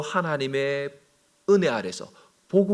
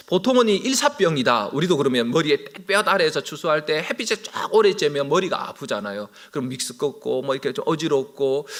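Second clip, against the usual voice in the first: ordinary talk, nothing marked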